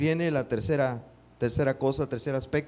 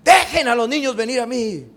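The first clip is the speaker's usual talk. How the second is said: loud, higher angry shout; angry command; 8 semitones higher, 10 dB louder than usual. Shown as a demonstration. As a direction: bark the order angrily, much louder. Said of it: "como cuando uno está enojado". (orden enojada, más fuerte y aguda)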